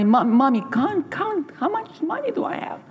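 put-on a child bursting to count his money; excited child. Boy asking mother to count his savings, high and excited.

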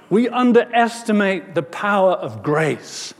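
preacher lifting the refrain out of a hush; emphatic preaching. Real, not acted. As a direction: proclaim it to the congregation. Refrain repeated, opening into full preaching.

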